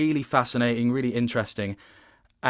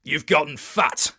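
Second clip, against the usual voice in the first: booming insult; 3 semitones higher, 4 dB louder than usual. Retold or imitated. Voicing Robert's insult.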